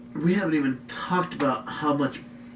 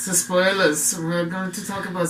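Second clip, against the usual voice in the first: fake German accent; 6 semitones higher than usual. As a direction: roll it out in a silly German accent. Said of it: "fake German, terrible German accents aside".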